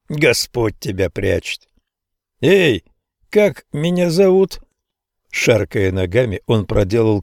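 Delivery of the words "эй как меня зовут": put-on high, teasing old man call. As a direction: needle him like a creaky old man. Old man quizzing the narrator.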